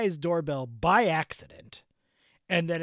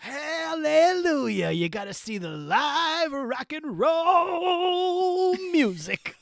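[name] voice; 5 semitones higher than usual. Pretend. [name] character urging the co-host to see live rock. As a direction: preach rock and roll like a revival. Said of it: "Why is [name]?".

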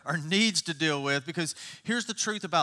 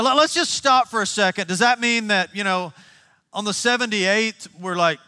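ordinary talk, nothing marked

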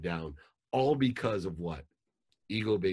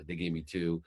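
normal talking voice

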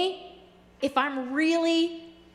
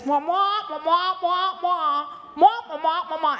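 nonsense wah-wah adult voice, a bit higher; Peanuts teacher voice; 4 semitones higher than usual. Retold or imitated. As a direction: mwah mwah like the muffled teacher in Peanuts. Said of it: "It always sounds like this".

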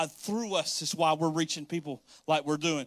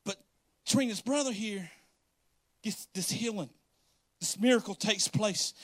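usual speaking voice

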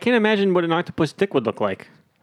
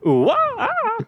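high hooting monkey calls; monkey sounds; much slower than usual. Pretend. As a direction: hoot like a monkey. Monkey imitation continues.

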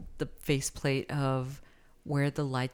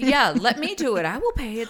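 mocking partner; 7 semitones higher, 7 dB louder than usual. Mocking partner's bill offer.

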